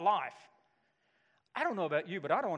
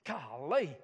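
awed surprise; slower than usual. Retold or imitated. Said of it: "John just wakes up and goes".

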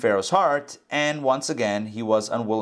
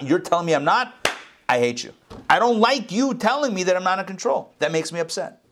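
mock-indignant and pitched higher, like someone told they're not in charge; indignant ego; 7 semitones higher than usual. Preacher voicing a proud listener who hates the truth, mock-indignant. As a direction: play the offended self who hates hearing the truth.

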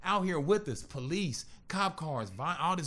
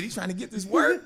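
laughing; 7 semitones higher, 4 dB louder than usual. Real, not acted. Laughing while retelling a confrontation.

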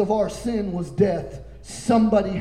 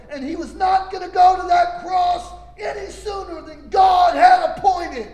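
higher and louder, shouting the point home; full-force preaching; 5 semitones higher, 5 dB louder than usual. Acted. Preacher proclaiming the appointed hour to the congregation, shouting. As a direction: preach it hard, building to a shout.

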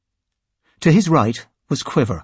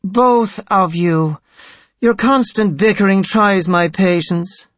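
higher-pitched, exasperated priest voice; weary impatience; 6 semitones higher than usual. A narrator's reading. High Priest tired of clerics' bickering, exasperated. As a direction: rebuke them with tried patience. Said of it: "Tenebrae sighed".

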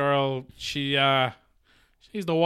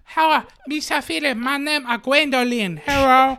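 put-on high Welsh girl voice; sweet high Welsh girl greeting; Welsh accent; 8 semitones higher than usual. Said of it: "The Welsh accent".

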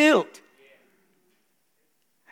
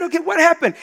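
high questioning; 9 semitones higher, 4 dB louder, faster than usual. Retold or imitated. Retold pastor asking gently.

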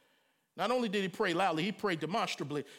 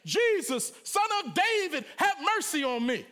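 much higher, louder desperate plea; crying out; 7 semitones higher, 4 dB louder than usual. A narrator's reading. Blind man by the road crying out to Jesus. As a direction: cry for mercy with all you have. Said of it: "a blind man cried out".

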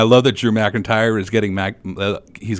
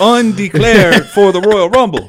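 high, loud, slow mock proclamation; mock announcement; 11 semitones higher, 8 dB louder, slower than usual. Cohost imitating R-Truth quitting the Rumble, loud and drawn out. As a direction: proclaim it like R-Truth with grand slowness. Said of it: "I am officially".

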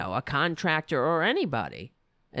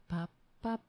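ordinary talk, nothing marked

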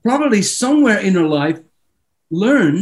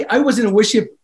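normal talking voice

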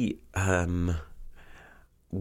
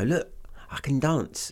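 imitating grandma; 7 semitones higher, slower than usual.

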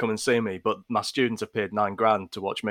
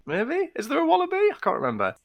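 much higher, a wondering rise; high mock wonder. Co-host asks the host in a very high voice. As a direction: float it up, wide-eyed.

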